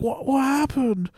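high, slow worried question; alarmed parents. Retold or imitated. Parents coming out to child, high alarmed question.

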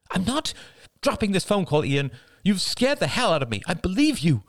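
high-pitched, heated wife; agitated protest. A narrator's reading. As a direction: refuse firmly, high and worked up. Wife arguing with husband.

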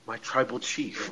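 fawning hushed sycophant; imitating a groveling adviser addressing his boss; Paul Heyman. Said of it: "Paul Heyman throughout the whole show says".

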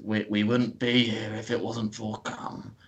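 lightly voiced old man; a hint of a creaky old man. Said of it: "Why did you do an old man voice?".

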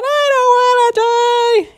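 very high, loud wail; mock-terrified squealing; acted terror. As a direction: cry it out in hammed-up dread. Terrified kid wails again.

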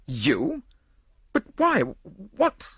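high, broken bursts of disbelief; spluttering. A narrator's reading. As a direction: splutter helplessly, pitch leaping up. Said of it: "the nobleman spluttered impotently".

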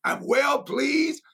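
high, slow ringing refrain; preached refrain. Real, not acted. Preacher quoting God to the church, slow and high. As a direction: stretch it out and lift it high. Preacher repeats God's words.

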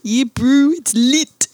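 put-on a bro-ish New Zealand accent. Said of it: "it sounded like you were doing a New Zealand accent".

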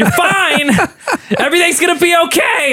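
high-pitched yelling at yourself; mock panicked yelling. Host re-enacting panic attack self-talk, mock yelling. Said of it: "like, start yelling".

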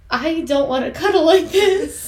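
high, loud, laughing whine; whiny laughing protest. Retold or imitated. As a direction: whimper it through a laugh like a sulky kid. Whiny retold quote about cuddling.

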